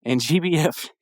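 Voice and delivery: ordinary talk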